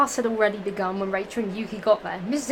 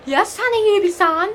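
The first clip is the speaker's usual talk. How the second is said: high character voice; 9 semitones higher, 10 dB louder than usual. Acted. Character speaks in the story.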